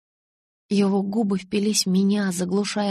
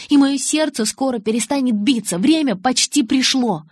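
urgent, higher and louder; urgent plea; 5 semitones higher, 5 dB louder than usual. Acted. Bella arguing that time has almost run out, urgent. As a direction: press the point with raised pitch. Acted heated dialogue line.

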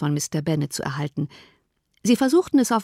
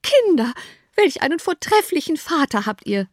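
much higher, gushing mother; delighted cry; 9 semitones higher than usual. A narrator's reading. Mother exclaims to her daughters, high delighted gush. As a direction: gush over the father with delight. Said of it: "rief die Mutter".

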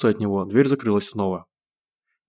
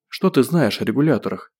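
plain reading voice

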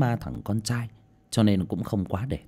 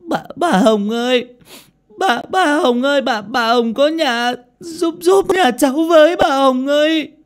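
loud, very high tearful wail; screaming while crying; 9 dB louder than usual. A narrator's reading. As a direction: bawl for help at the gate. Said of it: "vừa gào lên vừa khóc".

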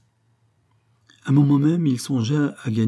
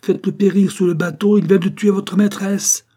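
much higher, much louder furious command; furious order; 9 semitones higher, 8 dB louder than usual. A narrator's reading. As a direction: rage at the servants to punish him. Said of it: "ordonna-t-il". (Fabien ordonne aux domestiques avec fureur)

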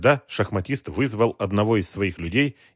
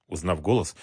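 ordinary delivery

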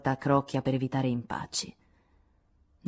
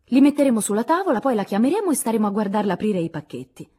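higher, louder, fast and eager; excited answer; 5 semitones higher, 8 dB louder than usual. A narrator's reading. Jo answers Meg about giving the gifts, excited, higher, louder and fast. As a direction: rush out the plan with enthusiasm. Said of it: "rispose Jo".

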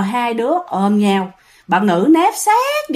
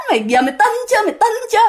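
mocking; 9 semitones higher, much faster than usual. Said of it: "mà cái giọng giễu cợt".

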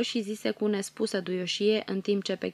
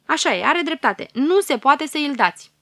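louder agreeing fairies; noisy agreement; 7 semitones higher, 10 dB louder than usual. A narrator's reading. Fairies backing the fox fairy, a louder eager agreement. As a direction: call out your agreement together. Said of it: "încuvințară zgomotos".

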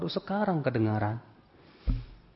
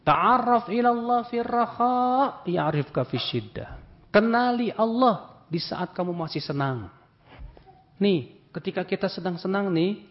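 loud, higher, fervent sermon delivery; fiery preaching; 5 semitones higher, 4 dB louder than usual. Acted. Preacher raising his voice to the congregation, fiery. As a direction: preach it out with full force.

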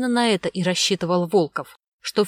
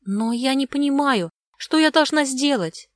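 put-on high, plaintive child's voice; 6 semitones higher than usual. A narrator's reading. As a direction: plead helplessly in a high girlish voice. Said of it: "простонала Огнева".

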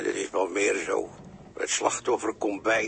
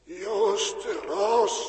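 higher, otherworldly call, stretching Ros; eerie call; 10 semitones higher than usual. Acted. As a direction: lure him with a haunting cry. Spookachtige stem roept Joost. (de geest roept Joost nadrukkelijk en spookachtig)

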